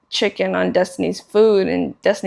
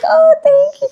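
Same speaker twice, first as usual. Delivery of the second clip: very high, loud squeal of thanks; imitating flattered waitress; 7 dB louder than usual. Waitress reacting to a compliment, imitated high squeal. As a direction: squeal it like she's flattered and laughing. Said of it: "She's like".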